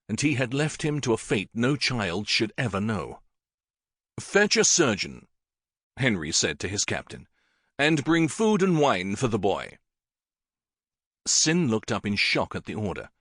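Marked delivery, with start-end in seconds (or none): 4.2-5.3 higher, firm order; king orders his captain, firm; king's command. Acted. 7.8-9.8 higher, firm order; king orders his captain, firm; king's command. Acted.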